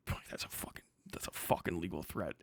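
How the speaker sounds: a hushed, breathy-free whisper of mock alarm. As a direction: whisper it like a secret scandal. Mock alarm at a legal threat.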